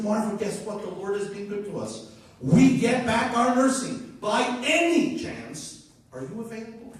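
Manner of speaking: high, loud good-news phone call; excited caller retelling. Retold or imitated. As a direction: share great news, pitched high and loud, then ease into asking. Retold joyful phone call to nurse.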